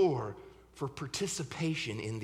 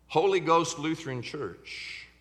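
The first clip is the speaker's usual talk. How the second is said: ordinary delivery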